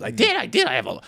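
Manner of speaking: high, proud engineer voice; mock Michael voice. Seatmate boasting about his oil background, high and proud. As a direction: brag it up high. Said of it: "I'm kinda going Clemmer now".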